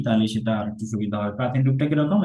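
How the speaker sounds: usual speaking voice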